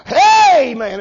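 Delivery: loud, high mock greeting to the crowd; mock worldly crowd talk. Preacher acting a churchgoer with worldly friends, mock hearty greeting. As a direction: imitate hailing your worldly buddies, loudly. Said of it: "talk their talk and their language".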